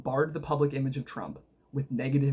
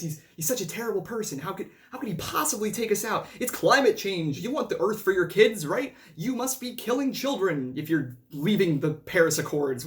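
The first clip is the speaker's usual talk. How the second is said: mock freaking-out leftist; 3 semitones higher than usual. Vlogger imitates the left freaking out over Paris Accords, mocking whine. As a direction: parody a panicking liberal, pitched up and indignant. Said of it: "the left freaking out and saying".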